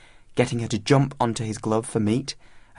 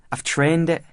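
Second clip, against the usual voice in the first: proud schoolboy statement, pitched up; higher-pitched, defiant claim of authority; daring assertion; 6 semitones higher, 3 dB louder than usual. A narrator's reading. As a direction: state it as if daring anyone to challenge you. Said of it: "as though daring anyone to challenge this authority".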